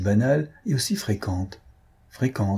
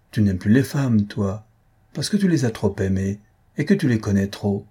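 usual speaking voice

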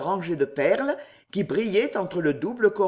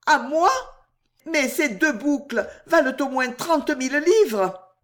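higher, louder, amazed disbelief; astonished; 8 semitones higher, 3 dB louder than usual. Acted. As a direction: gasp it out in disbelief. Astonished at the diamonds.

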